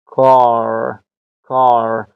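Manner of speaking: "کار" slowly, long "ah" into a light American R. Shown as a demonstration. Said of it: "بسیار نازک تلفظ می شود".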